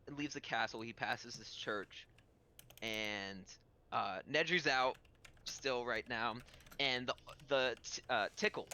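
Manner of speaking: ordinary talk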